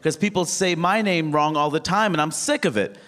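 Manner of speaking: imitating an upset man. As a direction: insist indignantly, high and quick at first. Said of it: "he got upset".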